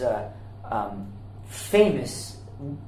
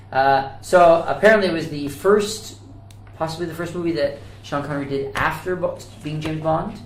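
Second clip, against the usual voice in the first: normal talking voice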